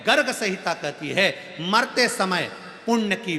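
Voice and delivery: loud, rhythmic sermon list; fervent preaching. Acted. Preacher urges the crowd to give charity, with fervour. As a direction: pound out each good deed.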